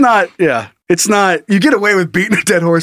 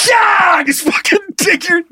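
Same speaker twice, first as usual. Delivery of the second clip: mock rider yell; 11 semitones higher than usual. Rider spurring the horse.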